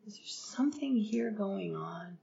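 hushed, almost whispered sincere question; sincere and soft. Shown as a demonstration. Asking a cold coworker gently what's wrong, in a hush. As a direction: ask it gently, barely above a whisper. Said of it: "And do it so sincerely".

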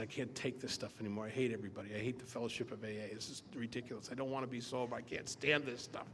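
low, soft, resigned grumbling; fed-up complaint. Retold or imitated. Newcomer telling a stranger he can't stand AA, fed-up grumble. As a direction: grumble it under your breath, low and defeated.

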